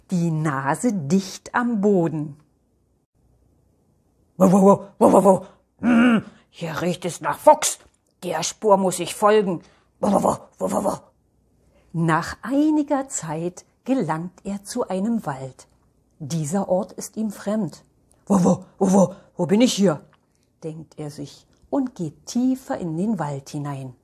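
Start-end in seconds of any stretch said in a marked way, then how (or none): 4.4-11.0 loud woofs, then a sniffing remark; talking dog hot on a scent; eager tracking dog. Acted. 18.3-20.0 loud woofs into a quick question; talking dog wondering where it is; barky, brisk puzzlement; puzzled dog. Acted. Lost dog character thinks aloud, barking.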